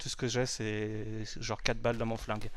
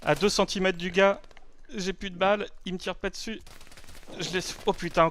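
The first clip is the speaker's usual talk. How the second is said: high, loud disbelief; excited; 7 semitones higher, 4 dB louder than usual. Real, not acted. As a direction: pitch up in frantic surprise. Out of ammo beside an enemy.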